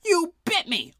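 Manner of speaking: high loud pained scream. A narrator's reading. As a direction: scream the accusation. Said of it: "The first guard screamed".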